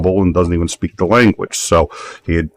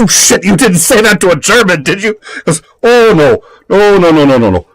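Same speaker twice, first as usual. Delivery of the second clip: much higher, loud horrified "oh no no no"; alarmed; 12 semitones higher, 6 dB louder than usual. Retold or imitated. Friend hears the polite German line, alarmed and loud. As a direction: panic that someone insulted a German.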